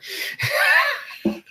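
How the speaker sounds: high, breaking burst of laughter; real high-pitched laugh; cracking up. Real, not acted. Laughs after mumbled grumbling.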